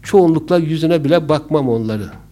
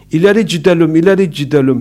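put-on loud, teasing Laz accent; mocking Laz accent; 7 dB louder, faster than usual. Passenger imitating the driver to annoy him, teasing Laz accent. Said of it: "Laz şivesiyle biraz gıcıklık olsun diye".

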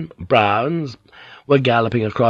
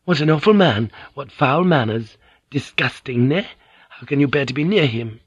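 put-on disgusted young Fujiko; 4 semitones higher than usual. Fujiko to Mariko about Blackthorn, higher and disgusted. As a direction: spit out her disgust at the foreigner.

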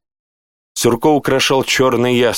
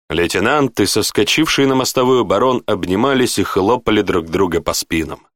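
plain reading voice

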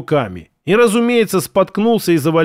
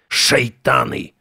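hoarse voice; 6 semitones lower, slower than usual. Acted. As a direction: rasp it out slowly and low. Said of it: "сзади раздался хриплый голос".

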